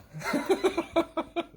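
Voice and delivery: a high burst of laughter at his own joke. Real, not acted. Laughing at his own bulldog line, high and bright.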